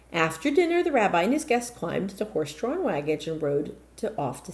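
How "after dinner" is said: plain reading voice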